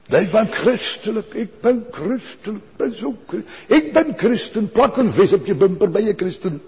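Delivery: high, mocking smug insistence. Mocking self-proclaimed Christians.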